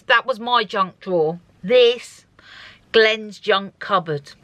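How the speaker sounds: normal talking voice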